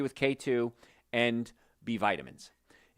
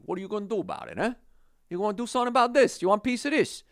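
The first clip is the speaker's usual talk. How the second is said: higher, louder, fast street-tough challenge; New Jersey tough guy; 10 semitones higher, 3 dB louder, faster than usual. Retold or imitated. Doctor taunting listeners as a Jersey tough guy. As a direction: square up like a Jersey tough picking a fight. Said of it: "like a tough guy from New Jersey".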